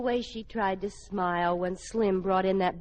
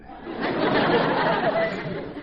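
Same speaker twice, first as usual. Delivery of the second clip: high, unvoiced burst of laughter; breathy laugh; 14 semitones higher, 5 dB louder than usual. Real, not acted. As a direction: crack up at the joke. [name] laughs at George's joke.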